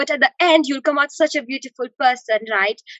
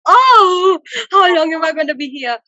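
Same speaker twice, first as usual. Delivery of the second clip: mock wail; 3 semitones higher, 4 dB louder than usual. Student retelling being stuck abroad, a mock wail.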